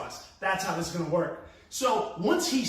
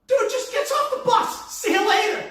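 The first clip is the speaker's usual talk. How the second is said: laughing disbelief; 7 dB louder than usual. Retold or imitated. Mock-voicing the fleeing driver.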